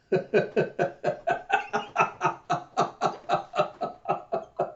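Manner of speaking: long voiceless staccato laugh; acted cackle. Jayha laughing on hearing about the posters, acted cackle. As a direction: wheeze out a long mock cackle.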